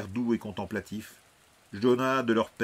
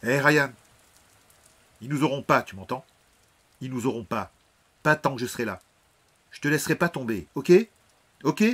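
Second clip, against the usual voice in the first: firm and louder, reassuring his brother; 5 semitones higher, 8 dB louder, faster than usual. Acted. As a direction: grip him with your voice, steady and strong. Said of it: "d'une voix ferme". (voix ferme et forte pour rassurer son frère)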